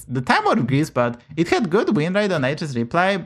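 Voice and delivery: ordinary talk, nothing marked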